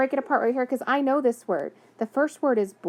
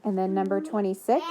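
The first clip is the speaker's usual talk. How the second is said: ordinary talk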